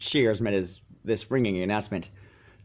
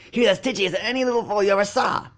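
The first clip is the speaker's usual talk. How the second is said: high quick filly voice; filly backing up her friend, high and quick; Scootaloo chiming in; 8 semitones higher, faster than usual. A narrator's reading. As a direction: chime in fast and high. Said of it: "chimed in Scootaloo".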